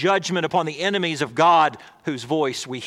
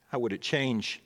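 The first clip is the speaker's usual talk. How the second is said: ordinary talk, nothing marked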